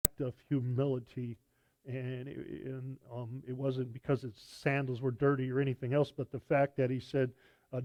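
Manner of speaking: ordinary delivery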